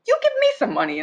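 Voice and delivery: very high-pitched re-enacted tirade; retold going off. Mother re-enacts going off on a man at her car window, high-pitched anger. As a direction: go off on him, voice shooting way up. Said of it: "I just went off on him".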